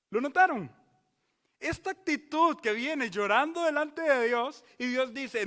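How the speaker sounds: loud, high, pounding each phrase; fiery pulpit preaching; preaching at full force. Acted. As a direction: hammer the image of tears on the altar.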